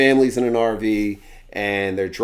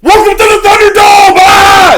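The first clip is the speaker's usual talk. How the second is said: much louder, much higher announcer yell; hammed-up shout; 14 dB louder than usual. Show's cliffhanger hitting the viewer, hammed-up high yell. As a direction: bellow it like a wrestling promo. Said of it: "they're just like".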